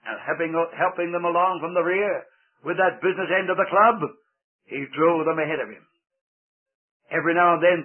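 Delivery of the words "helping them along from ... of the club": ordinary delivery, nothing marked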